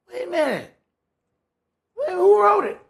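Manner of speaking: high, loud, disbelieving cry; incredulous outburst. Acted. Preacher to his Bible study, mocking the tempter's quote, incredulous high outburst. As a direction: catch the devil out in sudden disbelief.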